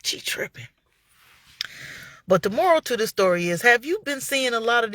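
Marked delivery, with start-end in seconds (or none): 0.0-0.7 soft whispered verdict; hushed gossipy aside. Retold or imitated. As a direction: whisper it like a secret. Thinking aloud at the trial.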